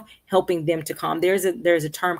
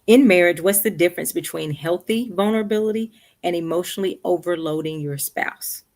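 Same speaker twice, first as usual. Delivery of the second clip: ordinary talk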